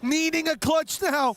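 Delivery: high, lively play-by-play call; esports caster. Real, not acted. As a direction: call the fight as it unfolds. Caster calls fight play-by-play.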